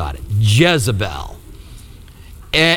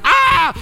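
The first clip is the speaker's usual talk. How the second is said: sudden high, loud yelp of fright; mock panicked scream; scared yelp; 4 dB louder than usual. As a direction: shriek like a man who bolts in terror. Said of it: "he's like".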